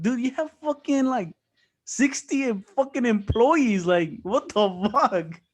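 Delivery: high, drawn-out indignant rant; exasperated disbelief. Retold or imitated. Thinking about the boss's lie, high exasperated disbelief. Retold exasperation at boss keeping him.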